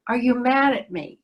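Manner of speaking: ordinary delivery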